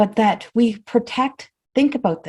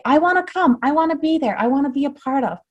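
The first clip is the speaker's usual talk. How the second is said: higher, heartfelt voicing of an unspoken wish; longing wish; 6 semitones higher than usual. Voicing what listeners would love to say, longing. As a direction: voice a longing to join in, pitched up. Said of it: "you truly would love to be able to say".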